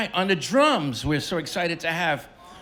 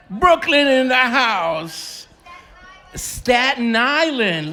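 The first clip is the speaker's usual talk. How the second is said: slow, loud, higher crowd call; hype call-out; 7 semitones higher, 7 dB louder, much slower than usual. Real, not acted. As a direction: stretch it out to hype the room. Calling out to the crowd.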